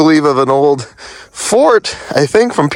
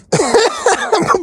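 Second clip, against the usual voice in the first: embarrassed laugh. Real, not acted. Laughing after slipping on ice.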